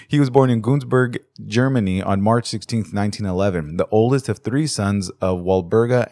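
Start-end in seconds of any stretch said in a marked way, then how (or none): none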